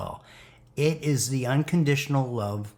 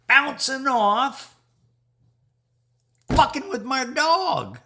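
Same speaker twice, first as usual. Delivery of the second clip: high, loud, slow taunt; high taunting shout; 13 semitones higher, 7 dB louder, slower than usual. Real, not acted. Taunting dark spells.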